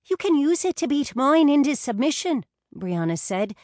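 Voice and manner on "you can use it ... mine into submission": playful. A narrator's reading. Brianna jokes loudly.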